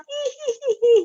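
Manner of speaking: shrieking high, loud hee-hee laugh; possessed cackle imitation. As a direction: mimic a possessed neighbour's piercing giggle. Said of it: "si orangnya itu ketawa".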